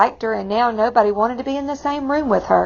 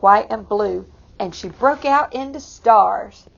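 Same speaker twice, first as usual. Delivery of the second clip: plain reading voice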